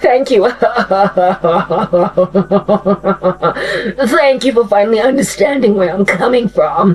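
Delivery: hammed-up slimy Freddy Krueger; creepy grateful Freddy. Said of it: "And Freddy's like".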